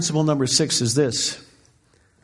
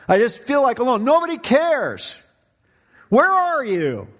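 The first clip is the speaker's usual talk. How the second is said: retold anguished outcry to God, pitched high; crying out; 8 semitones higher than usual. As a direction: cry out to God in halting, high-pitched bursts. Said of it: "I remember crying out to the Lord".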